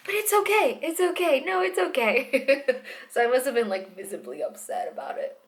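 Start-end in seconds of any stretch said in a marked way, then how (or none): 0.1-2.6 high, sing-song soothing repeated okay; mock reassurance. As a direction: imitate a nurse soothing too hard, slowing at the end. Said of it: "she's like".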